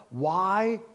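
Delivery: very slow, inward, pondering question; like a man searching his own heart; slow self-questioning. Retold or imitated. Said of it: "trying to ask himself".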